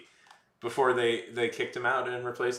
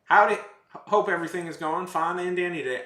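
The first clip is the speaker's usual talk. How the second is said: put-on Southern accent; 6 semitones higher, 7 dB louder, faster than usual. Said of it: "I have this accent I've adopted".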